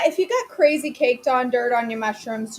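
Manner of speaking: ordinary talk, nothing marked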